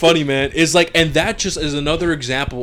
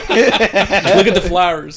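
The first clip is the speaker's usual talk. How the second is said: higher, slow, laughing; laughing quote; 7 semitones higher, 6 dB louder, much slower than usual. Retold or imitated. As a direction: drawl it out through laughter.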